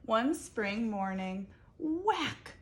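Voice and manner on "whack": whack sound effect. A narrator's reading. Narrator acting out the branch hitting Abel, loud whack. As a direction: snap out whack high and bright.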